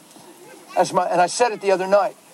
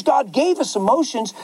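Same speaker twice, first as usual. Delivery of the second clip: usual speaking voice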